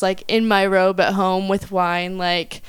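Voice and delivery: ordinary delivery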